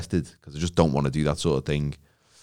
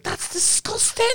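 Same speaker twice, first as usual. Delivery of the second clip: high, slow, breathy recoil; disgusted; 4 dB quieter, slower than usual. Retold or imitated. Child recoiling at the news, whispered and high. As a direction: draw it out in horrified disgust.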